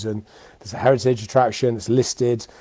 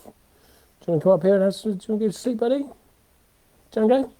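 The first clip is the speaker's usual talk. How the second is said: much higher, cooing pet talk; talking to the dog; 6 semitones higher than usual. Real, not acted. To the puppy after Suki nipped him, high soothing pet talk.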